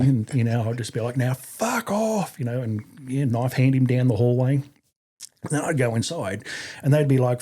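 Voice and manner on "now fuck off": high, long drawn-out send-off yell; slow, hammed-up drill bellow at held-back volume; showy mock dismissal. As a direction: stretch it out like a contest-winning dismissal. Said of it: "see who could do the best fuck off".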